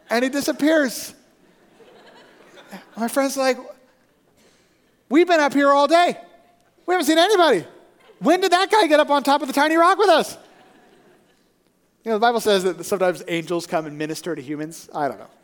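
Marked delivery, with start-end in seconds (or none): 5.1-10.4 high, loud mock disbelief; hammed-up yelling in bewilderment; baffled protest. Friends baffled by the vanished stranger, loud protest.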